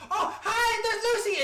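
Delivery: high, loud, laughing delight; thrilled shriek of recognition; excited squeal. Acted. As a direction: burst out with giddy joy. Excited character greets in magic place.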